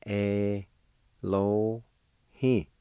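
slow, each sound held apart; syllable by syllable. Shown as a demonstration. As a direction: sound out "Elo He" with pauses between. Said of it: "First part is pronounced like this".

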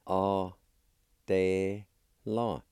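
slow, each syllable held apart; teacher's careful syllable demo. Shown as a demonstration. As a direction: sound out "A, te, la" with long pauses. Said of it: "First part is pronounced like this".

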